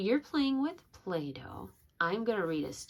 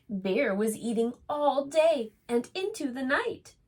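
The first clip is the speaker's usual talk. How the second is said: gentle storybook reading voice; reading to children; 3 semitones higher than usual. A narrator's reading. Reads a picture book aloud.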